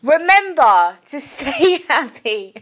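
announcer-style outro that dissolves in laughter; outro catchphrase. Shown as a demonstration. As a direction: deliver the catchphrase, then crack up.

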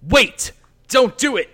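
high, loud plea to stop; acted desperate call to halt; urgent shout. Character urgently stops the cut-off.

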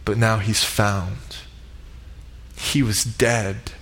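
tender joy. Retold or imitated. Father announces son's return.

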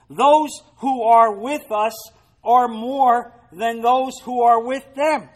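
loud, slow, ringing declaration; bold proclamation. Acted. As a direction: proclaim it to the hills. Prophet proclaims boldly.

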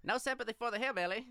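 put-on old-time newsreel announcer; 1940s announcer voice. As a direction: boom it out like a wartime newsreel. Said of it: "nineteen forties announcer voice".

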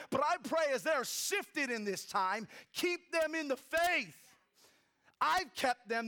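high, loud, impassioned sermon voice building to the prayer; fervent preaching. Acted. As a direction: preach it at full force, pleading with the Father.

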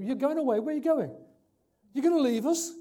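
alarmed disciples. Acted. Disciples voiced, alarmed at departure.

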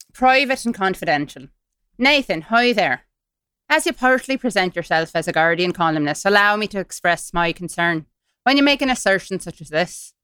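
put-on Irish accent, reading an email; formal letter in mock Irish. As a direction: read it with an Irish lilt. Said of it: "doing this in an Irish accent".

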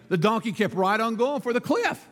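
ordinary talk, nothing marked

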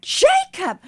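very high, delighted exclamation; enthusiastic. Retold or imitated. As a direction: cry the name out in amazed excitement. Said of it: "with that enthusiasm".

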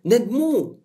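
high, calm call to bring him; Jesus gently summoning the blind man; a hint of a high summoning voice; lightly voiced command. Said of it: "قال لهم".

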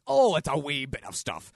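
hammed-up English-Scottish accent; weird English-Scottish accent. Shown as a demonstration. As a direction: belt out a silly Scottish accent. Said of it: "Some sort of weird English, Scottish accent".

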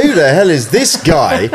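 high, drawn-out mock outrage at a baffling stranger; incredulous. Traveller retelling meeting a British stranger in New York, incredulous.

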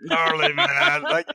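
high, far-off stoner turtle voice; gnarly dude turtle impression; gravelly turtle voice. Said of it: "with a gravelly voice".

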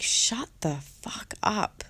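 slow, half-whispered retort to an inner voice; drawn-out, hushed exasperation; exasperated retort. Retold or imitated. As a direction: stretch it out in an emphatic whisper. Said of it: "I was just like".

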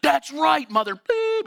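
high, loud, censored cursing; hammed-up road-rage yell; angry driver. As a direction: imitate a furious driver, squealing up on the bleep.

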